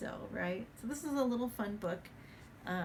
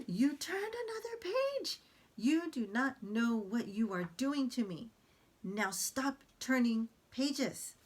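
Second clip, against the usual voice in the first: high, louder outraged puppet monster; dramatic Grover; 4 semitones higher, 4 dB louder than usual. Acted. Grover scolding the page-turning reader, high and loud. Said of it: "He's very dramatic".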